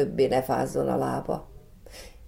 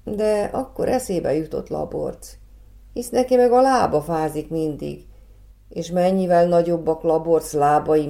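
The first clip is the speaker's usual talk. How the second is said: plain reading voice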